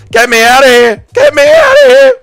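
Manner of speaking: panicked high screaming; horrified, very loud high yell; Laura Murphy. Acted. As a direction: scream to escape, climbing to a shriek. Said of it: "Impression of Laura Murphy accidentally walking".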